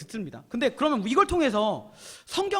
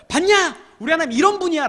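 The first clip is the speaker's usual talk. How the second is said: raised, louder proud exclamation; excited boasting; 6 semitones higher, 7 dB louder than usual. Acted. Israelites showing off their God after the miracle, excited boasting. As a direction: crow it to everyone, pitched up.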